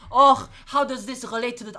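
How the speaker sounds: put-on puzzled student in a French accent. Teacher mimics someone overthinking note names, French accent. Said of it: "why am I doing a French accent".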